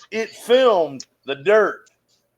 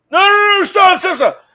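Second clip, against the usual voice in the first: high, loud mock yells of stop; frantic retold stop calls; retold panicked shouting; 5 dB louder than usual. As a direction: imitate range officers yelling her to halt. Men rushing her on line.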